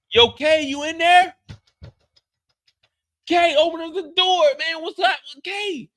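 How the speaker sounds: high, loud call through a closed door; yelling at the apartment door. Acted. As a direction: shout for K to open up.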